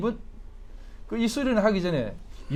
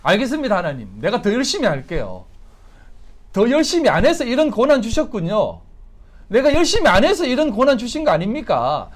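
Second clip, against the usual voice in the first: acted earnest protest, rising in pitch and volume; mock-zealous believer pleading; over-eager church's plea; 5 semitones higher, 6 dB louder than usual. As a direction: promise God harder effort, louder as you go. Acts out churches answering God.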